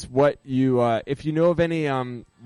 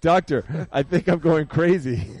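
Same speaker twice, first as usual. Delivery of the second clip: lightly acted patient, higher pitch; a hint of a worried patient in a joke; 4 semitones higher, 4 dB louder than usual. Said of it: "He says".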